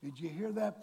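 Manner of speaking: usual speaking voice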